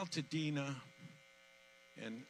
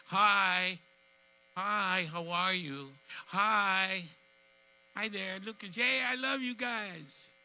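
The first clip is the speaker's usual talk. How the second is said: cheery greeting; 6 semitones higher, 4 dB louder, slower than usual. Real, not acted. Greeting children in crowd.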